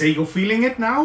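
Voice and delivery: eager NPC voice. Acted. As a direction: lean in and ask it keenly. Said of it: "He says".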